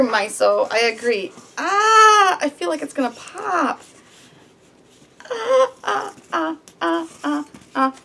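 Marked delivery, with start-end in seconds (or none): 1.6-2.4 high-pitched whimpering "ah"; anxious whine. Real, not acted. Balloon tier fears a pop, anxious whine. 5.3-7.9 string of short, high-pitched whimpers; anxious whimpers. Real, not acted. Balloon tier straining with the knot, anxious whimpers.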